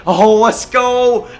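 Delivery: slow, loud, high let's go; drawn-out cheer. Real, not acted. Gamer cheering his shooter's bucket, drawn-out loud cheer. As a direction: stretch it out in triumph. Big shot goes in.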